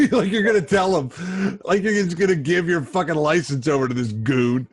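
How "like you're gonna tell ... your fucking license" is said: higher voice bubbling with laughter; laughing while talking. Real, not acted. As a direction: talk through a real laugh. Laughing at friend's story.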